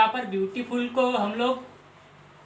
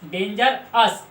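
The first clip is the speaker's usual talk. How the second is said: Dangerous said very slowly, syllable by syllable; split into syllables; 3 dB louder, much slower than usual. Shown as a demonstration. Teacher showing students the two syllables, slow and drawn out. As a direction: stretch dangerous out so each syllable is heard apart. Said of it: "ये दो इसके syllable होंगे".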